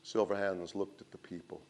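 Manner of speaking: ordinary delivery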